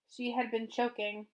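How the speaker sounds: plain reading voice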